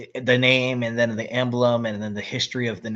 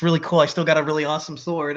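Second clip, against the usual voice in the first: mock excitement; 4 semitones higher, 6 dB louder, faster than usual. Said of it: "he's like".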